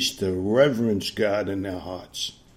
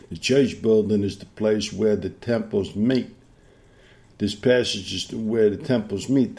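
ordinary delivery

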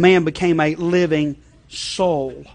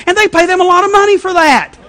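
much higher, incredulous; mock amazement; 13 semitones higher, 6 dB louder than usual. Real, not acted. Preacher joking about analysts' pay, high and incredulous. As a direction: marvel at the paycheck. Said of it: "mock amazement at analyst pay".

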